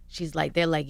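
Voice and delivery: ordinary talk, nothing marked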